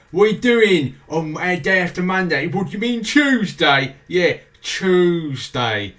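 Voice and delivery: mock London accent. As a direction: play a cheeky Londoner in a broad London accent. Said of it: "you go down to London".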